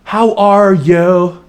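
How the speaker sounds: high, loud, very drawn-out punk-singer voice. Acted. Musician attempting an impersonation of a singer, exaggerated drawl. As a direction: stretch every vowel, loud and high. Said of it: "A [name] impersonation".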